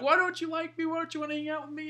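imitating a weepy, rejected friend; mock crying. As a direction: whimper a needy plea in a high voice. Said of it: "then he started crying".

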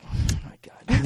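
slow, breathed dismay; exasperated whisper. Retold or imitated. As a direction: whisper it in weary disbelief. Exasperated retold reaction.

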